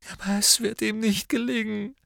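high, tearful protest; sobbing. A narrator's reading. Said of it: "schluchzte Narzissa".

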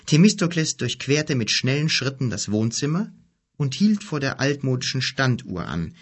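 ordinary delivery, nothing marked

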